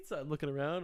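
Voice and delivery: ordinary delivery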